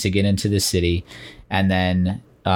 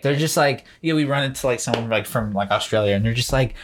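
ordinary delivery

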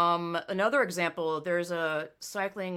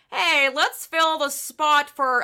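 high, loud, slow put-on announcer voice; mocking organizer; 8 semitones higher, 7 dB louder, slower than usual. Mimicking the race organizer filling the podium spot, put-on voice. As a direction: ham up the cheery organizer. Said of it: "the organizer was like".

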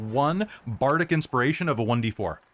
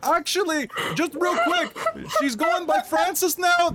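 bro heckler voice; 13 semitones higher than usual. Acted. GM holds heckler voice.

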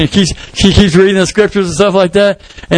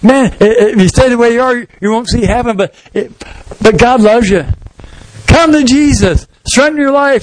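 higher, earnest gospel appeal; earnest pleading; 5 semitones higher than usual. Acted. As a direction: voice lifted high, urging the listeners. Convert pleading with the crowd.

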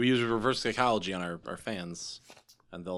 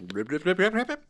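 rapid blips climbing in pitch; bubbly blip effect sliding upward; sound of healing; 5 semitones higher than usual. Acted. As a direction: make a rising cartoon healing noise. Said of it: "That's the sound of healing".

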